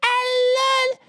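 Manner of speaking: high, slow mocking fake Spanish accent. Mocking the wife's press statement, high and slow fake accent. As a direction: drag out "alone" in an exaggerated fake accent. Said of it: "she's forgetting how to talk".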